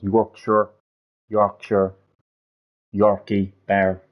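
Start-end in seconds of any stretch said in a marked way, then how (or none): none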